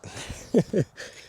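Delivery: short breathy laugh, high; a quick amused chuckle. Real, not acted. Laughs at own joke.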